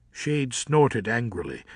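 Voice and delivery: usual speaking voice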